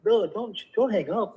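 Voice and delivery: high, urgent plea; excited. Real, not acted. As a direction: jump in to stop him, excited. Stream was about to end.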